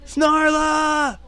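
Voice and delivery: high, loud drawn-out yell; hammed-up hero's war cry; battle cry. Companion attacks for his princess, high battle cry. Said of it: "His battle cry upon his lips".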